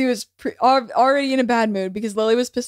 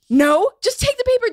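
exasperated; 9 semitones higher than usual. Retold or imitated. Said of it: "And Lily was like".

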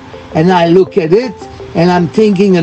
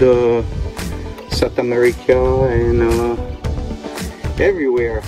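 tour host welcome; 5 semitones lower, 4 dB quieter than usual. Shown as a demonstration.

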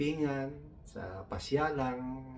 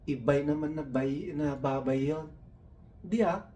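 1 excited mock argument; 5 semitones higher, 5 dB louder than usual. Heated teasing argument.

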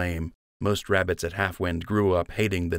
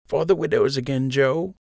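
put-on lightly higher elderly sister; kindly old votary, a touch higher; old woman voice; 7 semitones higher than usual. A narrator's reading.

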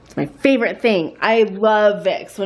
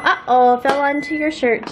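ordinary delivery

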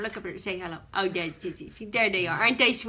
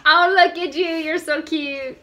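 cooing; 6 semitones higher, 4 dB louder than usual. Real, not acted. Telling a cutie they're adorable, cooing high. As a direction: gush over someone cute, pitched up. Cooing over someone cute on camera.

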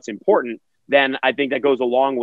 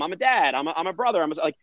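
normal talking voice

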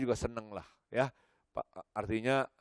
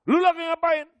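high, loud demanding father; gruff father voice; 10 dB louder than usual. Retold or imitated. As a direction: bark the question like a stern dad. Strict father questioning son.

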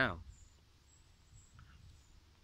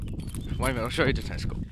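louder; 2 semitones higher, 8 dB louder than usual. Shown as a demonstration. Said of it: "repeats louder".

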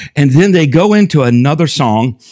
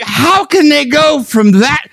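indignant outburst; 10 semitones higher, slower than usual. Retold or imitated. Preacher retelling his reaction to the radio, indignant and high. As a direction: blurt it out, scandalized. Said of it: "And I'm like".

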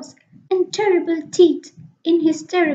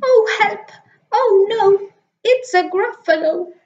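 mouse panics; 4 semitones higher than usual. Acted. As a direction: cry out in fright. Mouse sees Gruffalo.

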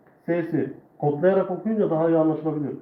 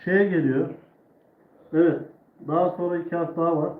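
ordinary delivery

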